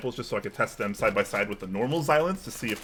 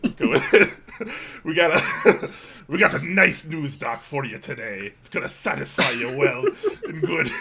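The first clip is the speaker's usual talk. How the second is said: high pirate voice cracking with laughter; laughing through a hammy pirate voice; pirate accent, laughing; 5 semitones higher, 3 dB louder than usual. Acted. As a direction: push on with the pirate bit through giggles. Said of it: "start with some kind of pirate theme".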